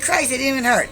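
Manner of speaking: high, exasperated retort; indignant protest. Retold or imitated. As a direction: imitate yourself brushing off the complaint. Protests to owner in retold quarrel.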